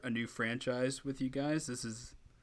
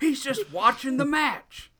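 wrestling commentator; 10 dB louder, slower than usual. Pretend.

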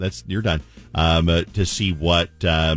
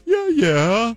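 mock liar voice; 14 semitones higher than usual. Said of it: "So I was like".

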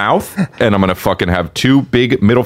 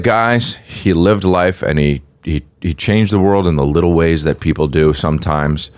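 mock eulogy; 6 semitones lower than usual. A comic rehearsing a mock eulogy at a friend's funeral. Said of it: "so I'm gonna be like".

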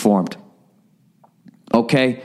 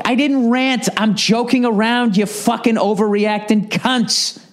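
sudden higher, indignant outburst at the listeners; wigged out; 7 semitones higher than usual. Real, not acted. Podcaster lashing out at listeners who correct him, indignant. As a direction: snap back hard, offended and worked up. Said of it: "fucking wigged out for a second there".

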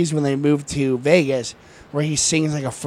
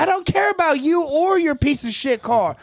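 high furious rant at the guy; angry rant; 7 semitones higher than usual. Retold or imitated. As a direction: tear into him over his stupid car. Angry cameraman voiced in story.

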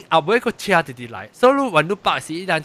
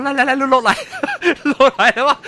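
higher, louder, laughing through the quote; retold flustered call; 7 semitones higher, 5 dB louder than usual. Stressed driver calling someone down to help park, flustered and laughing. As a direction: re-enact calling someone over, amused.

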